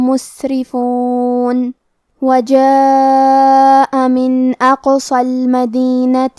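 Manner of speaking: slow, drawn-out melodic recitation; Quran recitation. Acted. Teacher recites the passage to students, melodic tajweed.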